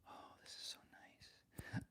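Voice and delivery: very soft, slow whisper; playful hushed mic whisper. Pretend. Playing close with the new microphone.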